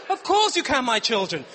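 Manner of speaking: loud, high beaming dad; grand giving in. Retold or imitated. Dad yielding to his children's second ask, loud and high. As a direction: give in grandly.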